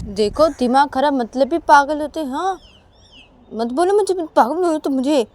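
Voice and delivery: high, loud objection; arguing Uma. Acted. Uma forbidding Sanju to call her mad, loud objection.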